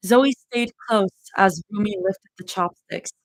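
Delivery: ordinary delivery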